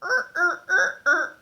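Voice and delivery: droning mechanical er-er robot motor sounds; robot noises. Retold or imitated. Little Freak stomping around the playground, droning robot noises. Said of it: "making these weird robot noises".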